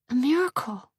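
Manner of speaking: put-on guard the baby in your words; defensive. A narrator's reading. Repeated softer.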